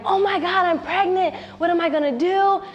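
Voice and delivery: put-on teen girl panicking over a positive test; scared panic. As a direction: blurt it out in a frightened panic. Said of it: "You know, I was just scared".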